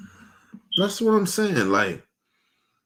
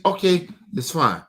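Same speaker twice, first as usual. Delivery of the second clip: ordinary talk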